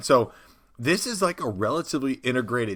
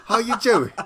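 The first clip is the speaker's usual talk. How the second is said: loud, high English goblin chimney sweep; English accent; 12 semitones higher, 11 dB louder than usual. Acted. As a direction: shout it out as a hammed-up English goblin. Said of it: "no more English accents".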